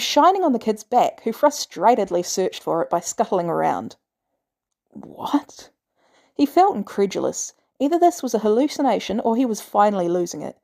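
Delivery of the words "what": whispered, disbelieving; barely voiced what; stunned whisper. A narrator's reading. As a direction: breathe it out in shock. Tony stunned by red dot.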